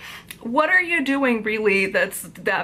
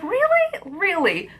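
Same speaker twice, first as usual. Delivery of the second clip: mock disbelief, first Really shooting much higher; incredulous; 10 semitones higher, slower than usual. Vlogger mocking the book's author, incredulous. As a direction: jump way up in disbelief.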